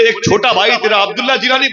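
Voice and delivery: high and fast, tumbling out; quick excited high question; rushed eager question. Acted. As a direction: blurt it out in excitement. Stranger eagerly questioning the saint.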